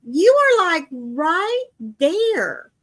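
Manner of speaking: incredulous nagging at a husband; a wife's replayed disbelief at her husband; exasperated. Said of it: "And I'm like".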